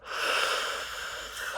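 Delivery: drawn-out, whispery exhale; slow, longing sigh; long breathy sigh. Acted. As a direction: let a long breath hiss out before speaking. Longing to confront the target.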